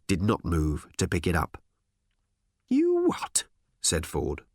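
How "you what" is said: very high-pitched disbelief; squeaked, dumbfounded challenge; incredulous. Acted. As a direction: shoot the question up in astonishment. Ford incredulous at the order.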